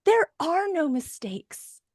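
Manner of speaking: slow, high reassuring line; gentle slow reassurance; calm reassurance. Retold or imitated. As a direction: settle the kid down slowly. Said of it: "your functional adult ... goes".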